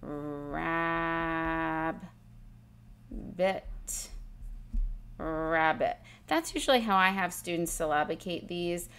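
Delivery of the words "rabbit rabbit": rabbit split into rab and bit, then said whole; syllabicating. Shown as a demonstration. Teacher modelling syllable split of rabbit. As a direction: break the word at the double b. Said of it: "closed in".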